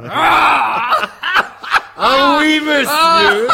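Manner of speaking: excited squeal. Acted. Patient squeals about the wine.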